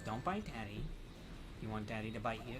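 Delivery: soft cooing pet talk. Real, not acted. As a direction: coo gently to a pet. Talking to a pet.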